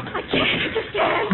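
winded, panicked near-whisper; breathless despair. Acted. Wife fleeing the robot, gasping in despair. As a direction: gasp it out, too spent to run.

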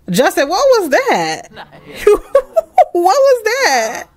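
laughing through the question; laughing disbelief. Real, not acted. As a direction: ask while cracking up. Laughing at clumsy seduction.

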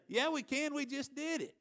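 high-pitched retold retort; defiant comeback. Friend answering the yelling crowd at the game, defiant. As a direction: imitate a friend answering back, pitch jumping up.

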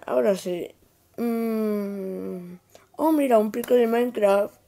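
ordinary talk, nothing marked

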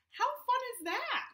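bright high teacher delight; excited. Real, not acted. Teacher enthuses to kids on Zoom.

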